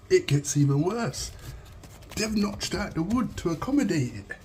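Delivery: incredulous. Real, not acted. Discovering worse wiring.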